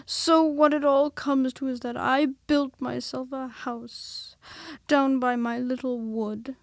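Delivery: lightly voiced mopey donkey, slow at first; a hint of a sorry-for-himself old donkey; gloomy donkey. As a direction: Eeyore voiced with understated gloom. Eeyore's held character voice.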